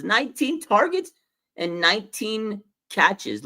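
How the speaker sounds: high, drawn-out disbelief at the numbers; amazed repeat. Real, not acted. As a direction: say it again like you can't believe it. Repeats the stats, even more amazed.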